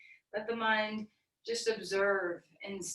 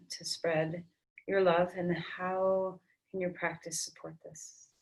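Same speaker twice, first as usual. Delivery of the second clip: guided meditation; 4 semitones lower than usual. Acted. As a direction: hush it down and guide inward. Closing meditation in yoga class.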